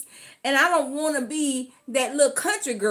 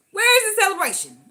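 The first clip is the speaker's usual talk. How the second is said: Where higher and louder in outrage; louder, higher exasperated question; indignant repeat; 8 semitones higher, 4 dB louder than usual. Shown as a demonstration. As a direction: ask again with mock indignation.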